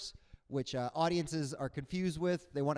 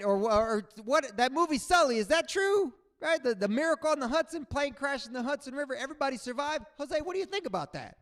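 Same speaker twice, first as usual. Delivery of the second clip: high, loud excited questioning; eager fan questions; 9 semitones higher, 4 dB louder than usual. Retold or imitated.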